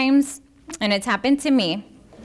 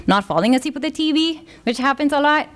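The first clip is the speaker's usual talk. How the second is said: ordinary talk, nothing marked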